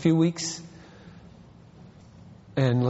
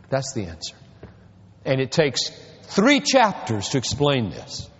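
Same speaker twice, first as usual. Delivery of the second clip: normal talking voice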